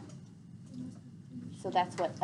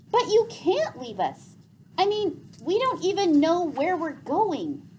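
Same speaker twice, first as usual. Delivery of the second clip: put-on higher, louder, urgent plea from a frightened boy; panicked boy; 8 semitones higher, 6 dB louder than usual. A narrator's reading. Gregor begging the leaving bats to stay, panicked. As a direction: beg them not to go, rising panic. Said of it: "filled Gregor with panic".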